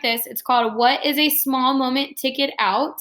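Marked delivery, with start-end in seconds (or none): none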